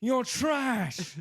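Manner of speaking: high, slow, loud mock yell at an old man; mock Gordon yelling. Gordon Ramsay berating an old restaurant owner, hammed-up high yelling. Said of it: "You are".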